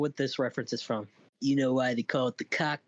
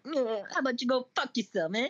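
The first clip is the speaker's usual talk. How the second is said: hammed-up Italian tough guy, pitched high; Italian accent; 10 semitones higher than usual. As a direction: put on your best mock-Italian voice. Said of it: "in my best Italian voice".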